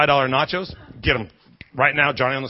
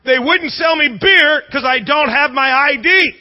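imitated high shout, slow and drawn out; screaming complaint; 9 semitones higher, 5 dB louder, slower than usual. Wife making eye contact with a stranger, screaming. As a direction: wail it loudly like the aggrieved wife. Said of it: "they're screaming at you".

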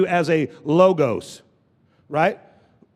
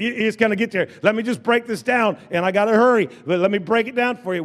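normal talking voice

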